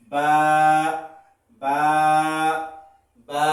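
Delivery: বা with both lips pressed, repeated; a crisp বা from the lips, said three times; drilled letter sounds. Shown as a demonstration. Teacher showing the lip sound to beginners, drilled. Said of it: "দুই ঠোঁট, ঠোঁট থেকে উচ্চারণ হবে".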